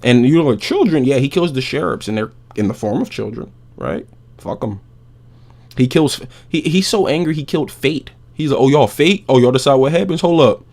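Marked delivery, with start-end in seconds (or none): 0.6-1.0 high, loud shocked question; a squawk of mock outrage; mock disbelief. 8.6-10.6 loud, higher taunting challenge; mock menace, louder and higher; mock Kratos challenge. Kratos confronting Fate, voiced.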